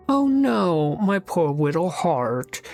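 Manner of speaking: high, drawn-out fake sympathy; mock pity. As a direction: coo it in mock heartbreak, starting high and stretching the words. Mocks the other's hurt feelings.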